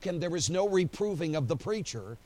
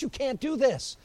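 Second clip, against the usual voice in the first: much higher, louder desperate plea; the preacher begging God, raised and urgent; pleading with God; 3 semitones higher, 4 dB louder than usual. Retold or imitated. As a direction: cry up to heaven, arguing. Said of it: "looks up to heaven and says".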